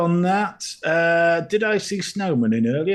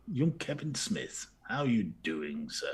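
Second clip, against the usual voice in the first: low sultry voice; 7 semitones lower, 10 dB quieter than usual. Acted. Sultry greeting to viewer.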